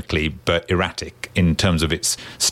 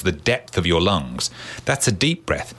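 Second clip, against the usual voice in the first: usual speaking voice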